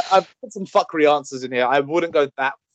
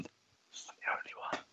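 soft unvoiced whisper; 22 dB quieter, much slower than usual. Real, not acted. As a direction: breathe it out under your breath. Echoing friend in a whisper.

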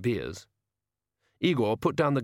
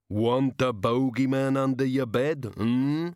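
leering bogeyman; 3 semitones higher, slower than usual. A narrator's reading. Bogeyman taunting Susan at the bar, slow leering menace. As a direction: lean in and taunt her slowly like a brute.

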